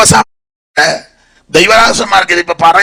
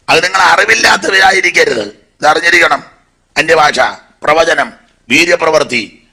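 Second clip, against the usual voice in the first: raised pulpit delivery, listing the gifts; emphatic preaching; 3 semitones higher than usual. Acted. Preacher teaching the church about spiritual gifts, emphatic. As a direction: proclaim each gift with conviction.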